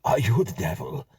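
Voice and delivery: a touch of frightened awe; gasped. Acted. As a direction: gasp it out in shock.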